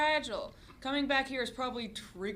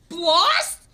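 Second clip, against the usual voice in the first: alarmed call; 5 semitones higher, 15 dB louder than usual. Acted. Sister calls out alarmed.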